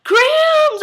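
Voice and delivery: high, loud squeal of the name; a sudden shriek of joy; excited squeal. Retold or imitated. Crying out to Grams on screen.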